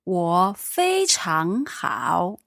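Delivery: ordinary delivery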